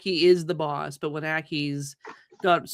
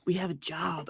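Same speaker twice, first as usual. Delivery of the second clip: hushed, sly undertone; 3 semitones lower, 11 dB quieter than usual. Acted. Player's character leaning on the fence to propose a job, sly. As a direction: keep it low-key and conspiratorial. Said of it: "trying to be all sly".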